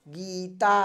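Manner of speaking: गीता said clearly through the mouth only; demonstrating a non-nasal word; oral demo word. Shown as a demonstration. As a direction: pronounce गीता plainly, all air through the mouth. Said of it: "इसी तरह से निकल रही है हवा".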